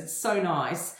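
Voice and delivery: usual speaking voice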